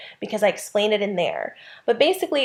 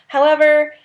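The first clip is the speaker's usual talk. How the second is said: emphatic however; 7 semitones higher, 7 dB louder than usual. Real, not acted. Teacher venting about comma overuse.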